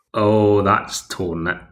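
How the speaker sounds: ordinary talk, nothing marked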